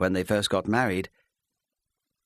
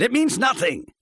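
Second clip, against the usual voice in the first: loud, higher shout with means hit; 5 dB louder than usual. Acted. Husband shouting that the visit means nothing, loud. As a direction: slam the denial shut. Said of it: "he shouted".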